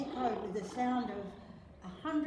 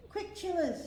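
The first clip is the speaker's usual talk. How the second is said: high, urgent hurry; 8 semitones higher, 3 dB louder than usual. A narrator's reading. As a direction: grandfather rushing the kids. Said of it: "said Granddude".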